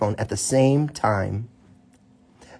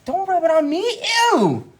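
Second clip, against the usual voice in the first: high loud disgusted squeal; grossed-out yelp; 5 dB louder than usual. Real, not acted. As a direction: recoil from the booger with a yelp. Dad grossed out by cat booger.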